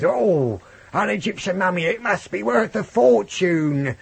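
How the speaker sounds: high, loud, drawn-out camp storyteller voice; hammed-up museum owner crowing with greed; Kenneth Williams. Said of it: "reading it now in the Kenneth Williams voice".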